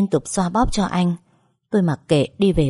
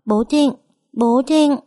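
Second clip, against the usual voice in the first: put-on high, loud child's call; 8 semitones higher, 3 dB louder, slower than usual. A narrator's reading. Little boy calling his waking father, high and loud. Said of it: "liên tục túm lấy anh gọi".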